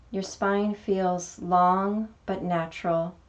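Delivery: calm meditation guide, long pauses between phrases; guided meditation. Acted. Teacher guiding beginners through a mini meditation, calm meditation voice. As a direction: lead gently into stillness, unhurried, letting each phrase settle.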